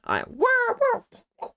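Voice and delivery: high loud wah like throwing a snowball; mock throwing cry. Showing how Laura chucked onions, high mock wah. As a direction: yelp it high as you hurl. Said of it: "She chucked 'em, like".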